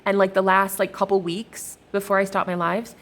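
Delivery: ordinary talk, nothing marked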